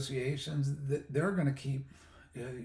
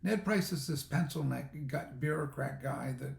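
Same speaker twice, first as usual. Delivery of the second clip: usual speaking voice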